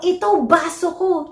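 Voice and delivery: put-on child answering, "baso" without the r; little kid showing off an arm, r dropped; cluster reduced. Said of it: "magiging baso na lang".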